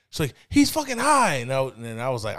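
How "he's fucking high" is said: high, loud accusation; outraged mom. Retold or imitated. Mom tells dad what their son did, outraged. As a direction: imitate a mother ratting out her son.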